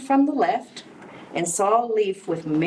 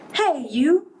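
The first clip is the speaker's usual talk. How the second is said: storybook shout; 9 semitones higher than usual. A narrator's reading. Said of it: "shouted the grouchy ladybug".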